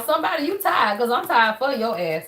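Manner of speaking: usual speaking voice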